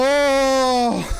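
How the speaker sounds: high, loud startled yelp; surprised shout. Real, not acted. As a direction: cry out in sudden surprise. Barely makes the jump.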